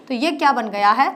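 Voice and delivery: usual speaking voice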